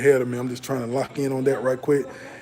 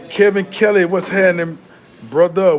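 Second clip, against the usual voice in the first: higher and louder shout-out to a viewer; hyped greeting; 4 semitones higher, 6 dB louder than usual. Real, not acted. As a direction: greet him like you're glad he showed up.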